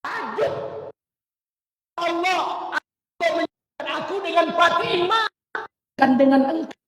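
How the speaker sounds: high crying-out voice; shocked outcry. Acted. Sulaiman crying out to Allah in shock, high and strained. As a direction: cry out to the heavens in shock.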